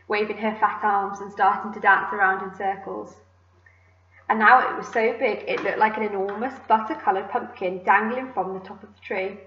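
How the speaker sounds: ordinary delivery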